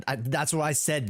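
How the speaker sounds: ordinary talk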